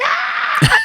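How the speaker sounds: high laughing shriek; excited shriek. Retold or imitated. Host meeting Victor Rivers, high laughing shriek. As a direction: squeal with delight. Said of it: "I was like".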